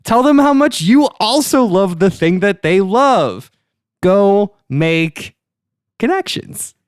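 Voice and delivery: rising, excited sales pitch; hype pitch. Acted. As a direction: sell it bigger and bigger. Pitch sells creepy app, rising hype.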